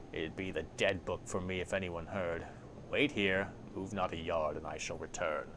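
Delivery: low-key, grudging grumble in character; grumbling Farid. A narrator's reading. Farid grudgingly does the player a courtesy, grumbling. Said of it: "Farid grumbles, shaking his head".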